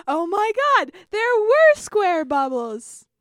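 high, loud, hammed-up astonishment; amazed excitement. Retelling the answer to sister about the shared dream, ecstatic amazement. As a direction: build to a slow, stretched exclamation.

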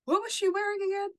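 high, quick, curious question; playful high question. Real, not acted. Friend asks about Phoebe's outfit, high and quick. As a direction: pipe it up fast and nosy.

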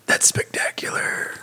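slow hushed whisper. Real, not acted. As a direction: breathe it out slowly. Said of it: "Why are we whispering?".

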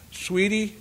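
imitating a gracious Southern lady; Southern accent. Susan coaxing Dave to her meeting, sweet Southern accent. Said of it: "she was a beautiful Southern accent".

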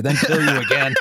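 words breaking into laughter; laughing. Real, not acted. Friends joke about a repeated death penalty, laughing. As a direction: crack up as you finish the joke.

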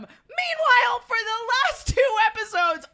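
high, loud exclamation; exasperated outburst. Real, not acted. Podcaster ranting about the villain's secrecy, exasperated outburst.

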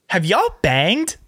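put-on high, slow Wyoming kid drawl; Wyoming accent. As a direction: imitate a little Wyoming girl, drawn out. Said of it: "such a Wyoming accent".

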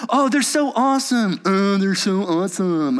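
mock starstruck admirer; gushing fan. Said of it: "how about celebrated people?".

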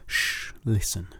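soft, slow shushing; hushing. A narrator's reading. Rhiannon silences Poik to explain her plan, hushing him. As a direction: shush him and command attention.

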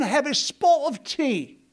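high, drawn-out mock British; British accent. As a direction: imitate children playing at British teatime. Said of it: "talking with a British accent".

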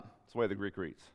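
ordinary talk

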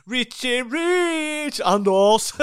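very drawn-out high jeer into laughter; imitated teasing holler. Friend jeering at rich Anders, laughing holler. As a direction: bellow it teasingly, then crack up.

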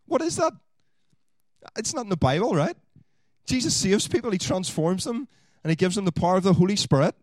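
high, loud, driving preacher's exhortation; preaching at full force. Acted. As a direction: hammer each command home like a rallying cry. Preaching continues, voice raised.

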